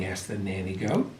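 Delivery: ordinary delivery, nothing marked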